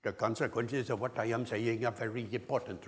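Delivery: syllable-timed Indian English accent. Shown as a demonstration. Lecturer demonstrating Indian English rhythm, evenly timed Indian accent. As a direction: give each syllable equal weight, Indian English style. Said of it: "hear it a lot in Indian English".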